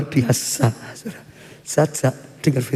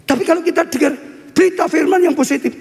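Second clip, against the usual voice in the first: high-pitched, punchy proclamation; raised preacher's cry; emphatic preaching; 11 semitones higher, faster than usual. Acted. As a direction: ring it out to the congregation. Preacher drives home his point.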